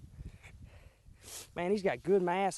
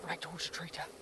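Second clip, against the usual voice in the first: hushed hunter's whisper; 9 dB quieter than usual. Real, not acted. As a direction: whisper so the deer won't hear. Sneaking up on deer.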